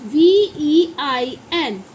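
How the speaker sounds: slow letter-by-letter spelling; measured classroom spelling. Shown as a demonstration. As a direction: spell it out one drawn letter at a time. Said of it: "तो इसे हम वेन पढ़ते हैं".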